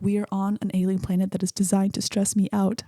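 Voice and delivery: normal talking voice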